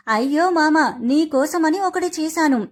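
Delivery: usual speaking voice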